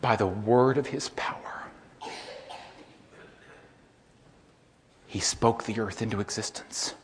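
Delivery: soft, hushed awe; reverent hush. Acted. Preacher describing creation, soft reverent hush. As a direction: speak it with quiet reverence.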